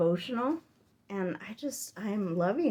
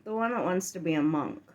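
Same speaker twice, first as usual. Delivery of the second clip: normal talking voice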